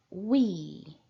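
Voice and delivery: "We" said as "ui", clear and rounded; model pronunciation. Shown as a demonstration. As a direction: show the sound of "We" like u-i. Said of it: "no som que essa palavra tem".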